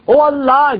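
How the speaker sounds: ordinary talk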